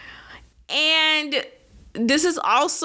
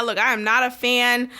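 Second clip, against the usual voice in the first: ordinary talk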